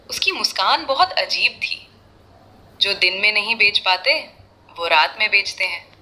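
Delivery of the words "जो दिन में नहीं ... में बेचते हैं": ordinary delivery, nothing marked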